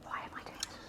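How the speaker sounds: whispered aside to herself; breathy whisper under the breath. Real, not acted. Muttered aside while eating hot wing.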